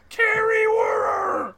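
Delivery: high, drawn-out shout; yelling. Acted. Naming another cast member, yelling. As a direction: bellow the name slowly. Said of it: "Why are you yelling at us?".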